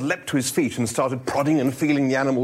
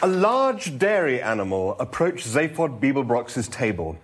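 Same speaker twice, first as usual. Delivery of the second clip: ordinary talk, nothing marked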